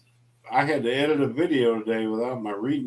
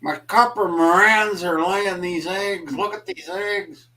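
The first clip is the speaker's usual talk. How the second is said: higher-pitched mock Jeff showing off eggs; a playful Jeff imitation, pitched up; imitating Jeff; 7 semitones higher than usual. Teasing his friend by imitating Jeff, pitched up. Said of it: "like you're Jeff".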